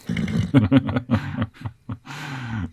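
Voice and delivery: drawn-out chuckling; amused laugh. Acted. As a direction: laugh along at the joke. Laughs at joke about horse.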